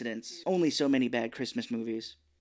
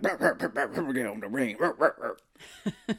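growling "rah rah" like an angry dog; mock growling grumble, half laughing; Tim Allen grumbling. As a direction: grumble in barks like a grouchy husband. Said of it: "That's his, uh, his catchphrase".

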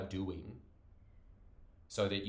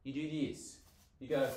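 usual speaking voice

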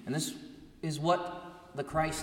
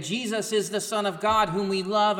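higher and louder, joyful praise; rejoicing; 8 semitones higher, 7 dB louder than usual. Retold or imitated. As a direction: lift it up in rejoicing.

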